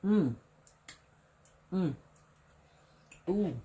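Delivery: pleased tasting hums; savoring hum. Real, not acted. Tasting the ranch.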